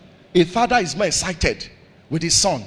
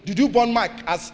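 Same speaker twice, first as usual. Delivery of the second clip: ordinary talk, nothing marked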